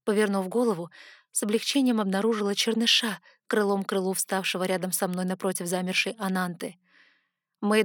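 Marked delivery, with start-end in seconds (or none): none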